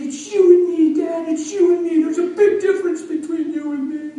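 high, loud, insistent son; upset boy. Retold or imitated. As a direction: push back hard like a frustrated kid. Boy insists through tears.

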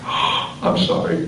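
gasp, then a slow, breath-heavy apology; acted gasp of dawning remorse; sudden realization. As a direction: imitate a gasped, drawn-out sorry. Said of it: "all of a sudden she said".

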